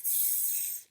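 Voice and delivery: soft unvoiced s hiss. Shown as a demonstration. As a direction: let the s hiss out. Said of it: "going s".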